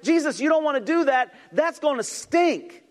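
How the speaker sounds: high, loud, half-shouted mock protest; Martha's protest. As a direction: warn Jesus off, near shouting. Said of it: "She's like".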